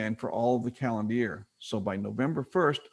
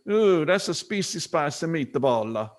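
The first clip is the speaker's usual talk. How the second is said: put-on higher, slow Italian accent; thick Italian accent; 4 semitones higher, 4 dB louder, slower than usual. Man retaking his meatball line, higher thick Italian accent. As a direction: exclaim it in a heavy, drawn-out Italian accent. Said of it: "with a thick Italian accent".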